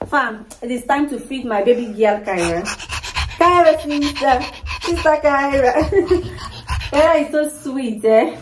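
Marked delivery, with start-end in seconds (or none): none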